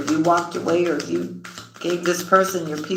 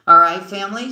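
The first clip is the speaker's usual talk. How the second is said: ordinary delivery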